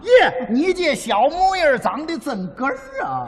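comic compliment in Tianjin dialect, stretched out. Shown as a demonstration. Comic praising partner's looks as Tianjin people would, Tianjin dialect. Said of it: "天津话".

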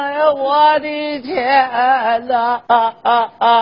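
slow, hammed-up wail over lost money; mock wailing. Stingy old widow wailing over one lost cent, mock sobbing. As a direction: drag out a comic old woman's sobbing. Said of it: "哭的那个伤心啊".

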